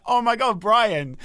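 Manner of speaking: high mock-thrilled exclamation; excited reaction. Co-host retelling seeing his friend pop up, mock excitement.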